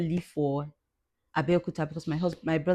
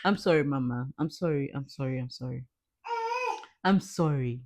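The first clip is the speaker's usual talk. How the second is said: soothing; 2 semitones lower than usual. Real, not acted. Soothing her crying mother.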